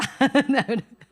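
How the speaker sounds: a loud laugh that bursts out. Real, not acted. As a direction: laugh at the husband's years of smoking. Laughs at husband's smoking.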